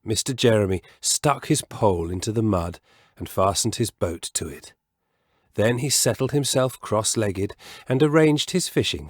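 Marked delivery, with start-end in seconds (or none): none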